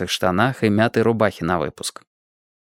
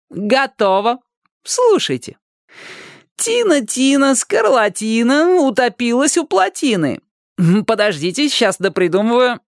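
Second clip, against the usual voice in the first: put-on much higher, sing-song boy's taunt; teasing rhyme; 14 semitones higher than usual. A narrator's reading. Boy hanging from the bar taunts Tina with a rhyme, high and sing-song. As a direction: chant it to tease her.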